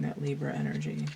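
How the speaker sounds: usual speaking voice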